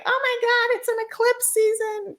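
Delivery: hammed-up drama-queen panic, very high-pitched; mock freaking out. Astrologers freaking out over eclipse season, mock high-pitched panic. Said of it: "us astrologers are freaking out like".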